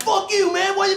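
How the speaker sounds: retold parking spot fury, loud and high; mock yell. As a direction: yell it like a road-rage rant. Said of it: "I was like".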